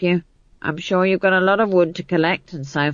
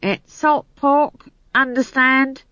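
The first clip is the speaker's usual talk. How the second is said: slow, higher, emphatic warning; sharp; 8 semitones higher, slower than usual. A narrator's reading. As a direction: spell it out sharply, word by word. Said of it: "said Howell sharply".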